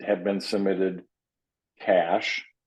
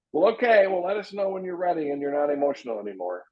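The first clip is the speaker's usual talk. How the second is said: higher, mock-breezy brush-off, lightly faked; dismissive brush-off; 8 semitones higher, faster than usual. Agent mimicking a bad brush-off to an emotional client, mock-breezy. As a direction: wave the client off with a falsely easy tone. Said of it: "is it best strategy to go".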